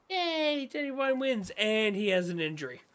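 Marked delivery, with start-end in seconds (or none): 0.1-1.5 high mock cheer; a pitched-up yay, half ironic. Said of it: "It's like".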